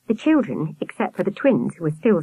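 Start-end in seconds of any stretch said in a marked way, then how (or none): none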